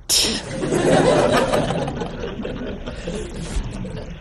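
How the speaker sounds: breathy high laugh; soft high laughter; airy laugh. Real, not acted. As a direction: laugh at yourself. Laughing at own story.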